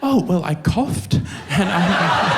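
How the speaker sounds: high, slow, giggling; giggling through a flimsy excuse; sheepish laughing. Retold or imitated. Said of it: "his own retold reply".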